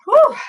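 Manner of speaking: breathless high whew. Real, not acted. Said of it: "I'm out of breath".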